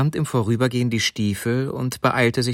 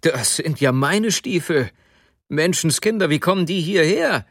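higher-pitched, amazed exclamation; sudden astonished cry; 6 semitones higher than usual. A narrator's reading. Sobotka recognising his own boots, astonished outcry. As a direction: cry out in disbelief, climbing in pitch. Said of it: "Plötzlich rief er".

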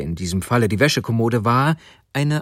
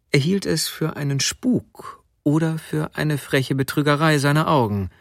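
ordinary delivery

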